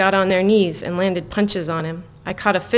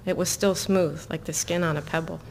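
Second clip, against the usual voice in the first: ordinary delivery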